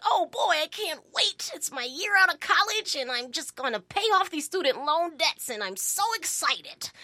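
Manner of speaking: giddy new hire in a fifties sitcom; 1950s sitcom voice. Acted. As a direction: play a naive fifties TV kid. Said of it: "I sound like a nineteen fifties Leave It to Beaver".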